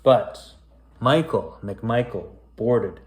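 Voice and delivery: spooky rhyming storybook read; story narration. A narrator's reading. Reading a creepy rhyming tale to kids, storyteller voice. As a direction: read it aloud like a bedtime ghost tale. Reads a spooky rhyme aloud.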